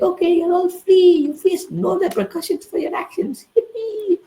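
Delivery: very high mocking falsetto; mock squeaky queen. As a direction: squeak it like a ditzy cartoon queen.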